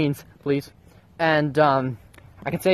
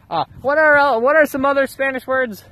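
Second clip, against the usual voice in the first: goofy high voice; 11 semitones higher, 5 dB louder than usual. Pretend.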